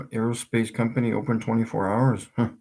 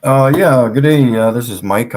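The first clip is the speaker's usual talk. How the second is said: loud; 2 semitones higher, 11 dB louder than usual. Acted. Prank caller opens the call.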